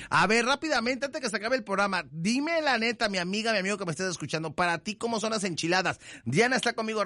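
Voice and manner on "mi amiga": ordinary delivery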